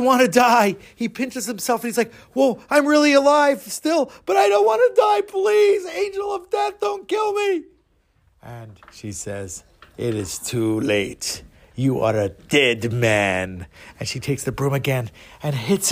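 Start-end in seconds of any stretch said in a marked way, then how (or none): 2.3-7.6 very high, mock-panicked begging; frightened pleading. Frightened man begs for his life. 10.0-13.7 low, slow, ominous voice; Angel of Death. Acted. Fake Angel of Death pronounces doom.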